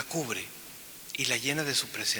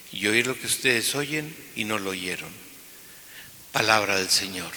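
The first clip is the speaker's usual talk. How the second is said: proclaimed Gospel reading; 3 semitones lower than usual. Acted. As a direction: proclaim it to the whole church. Proclama el Evangelio en misa. (lectura solemne del Evangelio)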